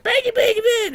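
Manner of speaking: imitating a squawky intercom speaker, very high; garbled high-pitched PA noise, mimicked; intercom garble. Little speaker inside the gym crackles out, imitated intercom squawk. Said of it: "a little speaker is like".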